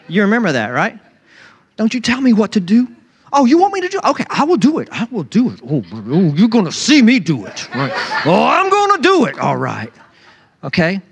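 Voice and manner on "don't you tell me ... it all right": put-on sulky defiant kid. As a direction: ham up a child who obeys resentfully. Sulky child defying a parent.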